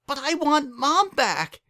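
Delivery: high, loud, slow desperate cry. A narrator's reading. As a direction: wail it at him like a child. Dinky cries out for mom.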